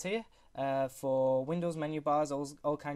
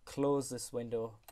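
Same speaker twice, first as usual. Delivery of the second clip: slow command voice; 2 semitones lower, slower than usual. Shown as a demonstration. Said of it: "So if I'm like".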